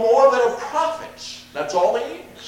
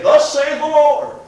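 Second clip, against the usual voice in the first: booming, slow prophet's declaration, higher and louder; prophet's proclamation; 6 semitones higher, 8 dB louder, slower than usual. Retold or imitated. Preacher imitating a prophet announcing God's word, slow and booming. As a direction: pronounce it like an Old Testament prophet. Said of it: "he'd say".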